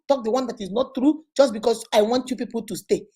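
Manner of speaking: ordinary delivery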